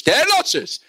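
high, urging gym yell; coach's shout. Retold or imitated. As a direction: yell the demand with pitch jumping up. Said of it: "והוא צועק לו".

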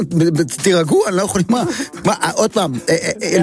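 ordinary delivery

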